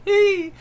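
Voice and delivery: put-on high-pitched girly squeal; cute squeal. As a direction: squeal it out like a fangirl. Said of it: "I'm being all Doshio".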